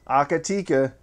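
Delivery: Akatika as "ah-ka-tee-ka"; maker's pronunciation. Shown as a demonstration. Host repeating the company's name their way, maker's pronunciation. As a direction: say Akatika with "ka" as second syllable. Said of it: "that's how they say it".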